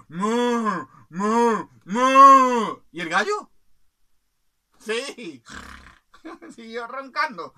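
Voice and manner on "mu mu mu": higher, very loud, growing moos; cow mooing. Acted. Cow mooing at the rooster, building to very loud. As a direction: moo louder each time. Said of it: "Y la vaca le dijo".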